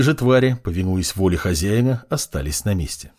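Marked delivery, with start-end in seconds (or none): none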